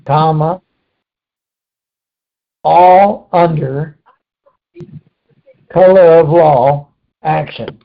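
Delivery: slow, drawn-out dictation; dictating slowly. Shown as a demonstration. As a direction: give it word by word slowly. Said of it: "write down mob".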